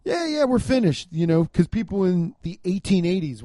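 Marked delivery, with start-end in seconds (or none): 0.0-1.1 high, breezy mock pride; sing-song fake heritage boast; mock proud Finn. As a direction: toss it off like a proud descendant. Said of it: "you're like".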